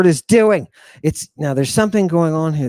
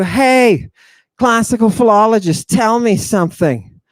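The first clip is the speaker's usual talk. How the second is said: raised pitch, hailing someone; calling out; 6 semitones higher than usual. Acted. Student accosts a classical philologist, calling out. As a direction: grab their attention with a higher call.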